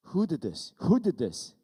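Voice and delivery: loud, high retold outburst; re-enacted angry demand, punched loud; angry dad. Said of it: "And I got angry".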